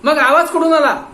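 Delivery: loud, high furious question; angry demand. Acted. Inspector furiously demanding where the sound came from. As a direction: hammer the question home.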